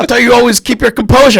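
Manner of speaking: high, loud laughing tease; teasing jab. Retold or imitated. Cohost mocking host's lost composure, loud laughing. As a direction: rib him, shouting through laughs.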